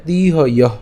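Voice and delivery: তিহয় with a long, held ee in তি; lengthened তি in তিহয়, breath at the end; long vowel. Shown as a demonstration. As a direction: stretch তিহয় to tee-hoyoh. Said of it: "একটু দীর্ঘায়িত করতে হবে".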